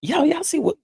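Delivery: high, loud disbelieving call; incredulous. Real, not acted. Asking viewers to see the comment, amazed and loud.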